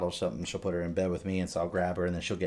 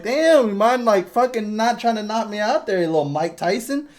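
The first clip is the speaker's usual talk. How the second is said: mock scolding; 13 semitones higher, 8 dB louder than usual. Dad scolding baby playfully.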